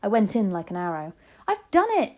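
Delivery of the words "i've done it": high, loud triumphant cry; excited cry. A narrator's reading. Child back with grandmother, crying out excitedly. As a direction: burst out jubilantly, pitched way up. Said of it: "I cried, jumping up and down".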